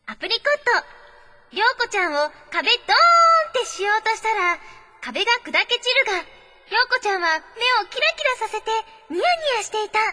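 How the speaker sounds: high, lively announcer read; dramatic letter reading. Acted. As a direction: read it out like a big reveal. Reads listener letter.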